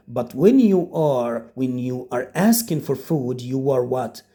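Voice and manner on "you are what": normal talking voice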